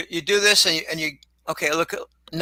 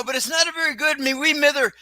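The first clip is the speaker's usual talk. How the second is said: mock Irish accent, raised pitch, a bit shouted on "me wee mither"; 7 semitones higher than usual. Shown as a demonstration. Host showing off his admittedly poor Irish accent. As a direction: ham up a stage Irish brogue. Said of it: "I can put on an accent".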